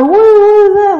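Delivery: loud, high, stammered disbelief; incredulous shout. Acted. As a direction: shout the question as if utterly baffled. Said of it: "I'm like".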